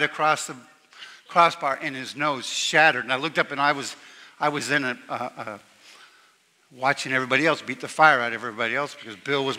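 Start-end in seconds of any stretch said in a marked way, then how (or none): none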